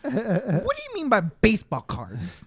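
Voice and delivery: high, slow squeaky guru voice, laughing; squeaky voice impression. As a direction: squeak it like Gary V. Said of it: "echoes Gary V line, laughing".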